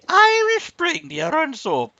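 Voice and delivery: put-on high, loud Irish accent, soap-ad style. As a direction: sing out the soap name in a mock Irish accent. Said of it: "What was that?".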